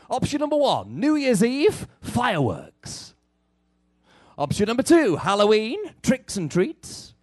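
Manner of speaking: bright game show host reading options; upbeat cruise director. Acted. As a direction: announce each option with showman punch.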